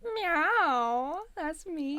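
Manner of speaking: put-on high cat girl meowing. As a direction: meow like a playful cat girl. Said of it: "you're leaning into that".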